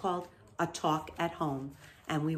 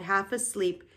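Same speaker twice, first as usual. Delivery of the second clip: usual speaking voice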